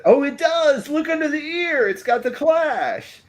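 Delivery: high, thrilled exclamation of discovery; suddenly spotting it, delighted and loud; excited discovery. Real, not acted. Spots the die clash on coin.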